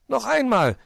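high, loud insistence; demanding. A narrator's reading. Borstel wants another race, demanding and loud. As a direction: demand it like an impatient youngster. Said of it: "forderte Borstel".

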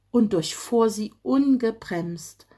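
ordinary delivery

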